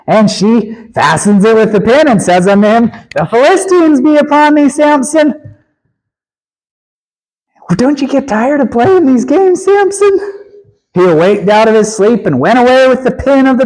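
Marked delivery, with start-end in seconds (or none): 3.1-5.3 calling out. Acted. Delilah calls out again. 7.7-10.2 mocking taunt. Preacher mocks Samson, rising high.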